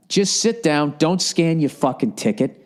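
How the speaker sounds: ordinary talk, nothing marked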